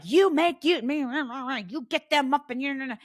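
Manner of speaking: hammed-up scolding parent voice; mocking bossy parents. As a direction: parody nagging parents. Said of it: "parents are like".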